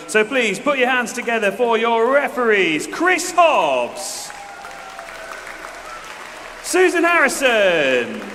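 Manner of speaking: excited announcer. Real, not acted. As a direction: pump up the crowd with each name. Announcer introducing the referees.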